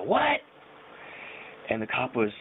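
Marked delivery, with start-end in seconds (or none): 0.0-0.4 high-pitched mock yell; retold yell. Drunk passenger yelling at the cop, high mock yell. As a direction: imitate a drunk yell out a window. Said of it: "And I yell out the window".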